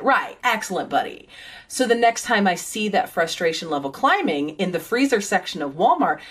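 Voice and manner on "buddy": ordinary talk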